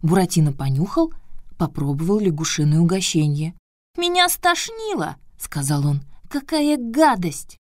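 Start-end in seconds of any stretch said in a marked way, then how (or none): 4.0-5.2 put-on high squeaky disgusted boy; disgusted puppet boy. Puppet disgusted by frog food. 6.3-7.6 high, slow disgusted cry. Acted. Puppet disgusted by food.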